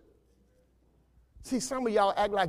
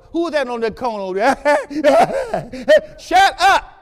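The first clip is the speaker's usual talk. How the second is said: laughing, higher and louder; teasing laugh; laughing tease; 5 semitones higher, 7 dB louder, slower than usual. Real, not acted. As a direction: laugh at the one on the corner. Mocking someone, laughing.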